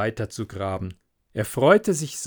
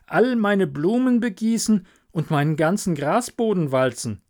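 higher, eager, sorry; a lightly voiced Hans begging off; apologetic little Hans; 6 semitones higher than usual. Hans to the miller, sorry but too busy.

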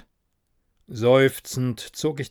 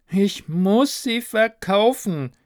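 high, drawn-out ghostly lament; plaintive wail; 8 semitones higher, 4 dB louder, slower than usual. Acted. Ghost laments unsold shares behind narrator, plaintive wail. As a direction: wail it slowly, high and needy. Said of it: "klagte Neaston hinter mir".

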